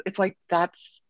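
ordinary talk

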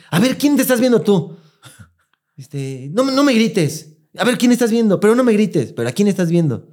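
upset daughter questioning her mother; mock heated demand. Mother confronts grandma, demanding answers.

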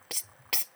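a soft, voiceless hiss to call someone over; the stranger in the waiting room hissing at them, a voiceless psst; imitated psst. Man hissing to get attention.

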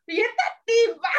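puzzled aside. Retold or imitated. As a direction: ask it half-amused, bewildered. Puzzled reaction retold.

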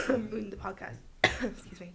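throat clearing after choking. Real, not acted. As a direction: still coughing, getting words out. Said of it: "I'm choking".

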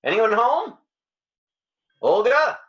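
loud, rising hello for anyone home; calling out. Acted. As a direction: call into a seemingly empty apartment. Calls out entering Olga's apartment.

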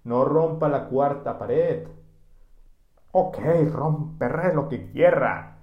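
loud, drawn-out playful defiance; mock bossy order. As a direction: play at laying down the rules. Mock quarrel between hosts.

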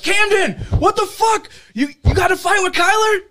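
high, loud incredulous yelling; retold friends' shout. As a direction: holler like friends from down the road. Retold friends calling out down road.

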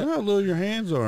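light mock of people teasing hands. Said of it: "When everybody's like".

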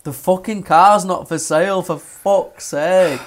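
fed-up shout; angry yell. Retold or imitated. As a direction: snap at the caller. Yelling at caller.

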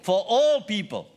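all punched, high and loud; loud call-and-response. Shown as a demonstration. Preacher leading congregation response, loud. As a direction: lead the crowd in saying it. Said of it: "Say that with me".

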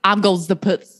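lightly voiced film character, slightly low; film character impression. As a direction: lightly imitate a comic actor's awkward line. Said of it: "he's like".

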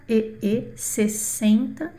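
ordinary talk, nothing marked

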